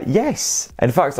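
ordinary talk

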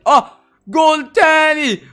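high drawn-out thrilled cry; thrilled at another find; excited. Real, not acted. Finds more gold.

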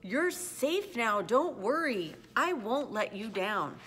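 loud, high, drawn-out reassurance; big elephant calling out a promise; calling back. A narrator's reading. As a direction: call down to someone tiny, slowly and loudly. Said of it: "Horton called back".

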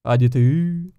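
ordinary talk, nothing marked

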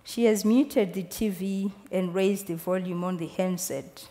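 usual speaking voice

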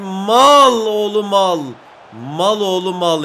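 slow, drawn-out angry yell; angry insult. Real, not acted. Player curses teammate over a bad pass, angry drawl. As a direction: stretch the insult out in real annoyance. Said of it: "Çok sinirlenmeye başladım".

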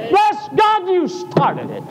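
high shout of "Bless God"; shouted praise. Retold or imitated. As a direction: cry it out in triumph. Crying out to God.

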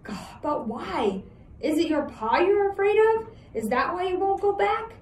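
put-on high, pleading boy; worried boy voice. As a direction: press him, anxious.

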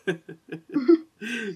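high, loud burst of laughter. Real, not acted. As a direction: burst out laughing at your own outburst. Laughs at her own outburst.

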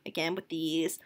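ordinary talk

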